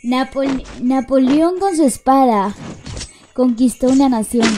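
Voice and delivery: recita el verso más fuerte, en tono teatral; piropo declamado. Acted. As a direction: declama el piropo como un poema. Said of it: "Ahí va un piropo". (lectura teatral del piropo al chat)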